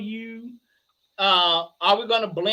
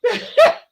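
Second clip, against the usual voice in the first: high laugh; 14 semitones higher, 9 dB louder than usual. Real, not acted. Laughing at own story.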